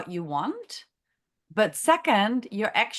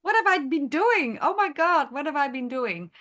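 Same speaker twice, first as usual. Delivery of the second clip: high squealing mock gasp of horror; shocked realization; 7 semitones higher, 4 dB louder than usual. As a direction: play the sudden dawning shock, loud and high. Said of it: "you go".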